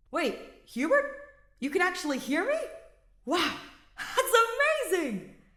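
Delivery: thrilled amazement, big and bright; cheering. Acted. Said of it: "Kaspar cheered through the amplifier".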